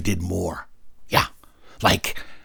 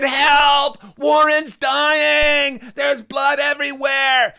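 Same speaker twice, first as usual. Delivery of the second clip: high, drawn-out panicked shout; hammed-up desperate kid scream; screaming for help; much slower than usual. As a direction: wail the alarm, stretching the words.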